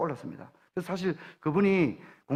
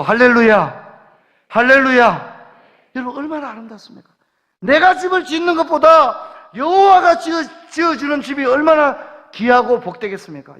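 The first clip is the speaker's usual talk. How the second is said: fervent preaching; 5 semitones higher, 6 dB louder than usual. Acted. Preaching at full force.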